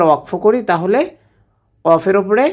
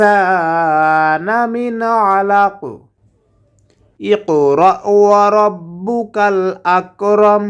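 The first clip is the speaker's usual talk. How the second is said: slow, drawn-out tilawat; Quran recitation; 3 semitones higher, much slower than usual. Shown as a demonstration. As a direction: chant it slowly for students to copy. Teacher recites next ayah. (শিক্ষকের ধীর তিলাওয়াত)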